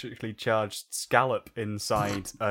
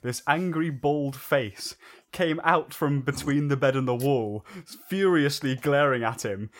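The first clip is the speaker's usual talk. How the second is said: normal talking voice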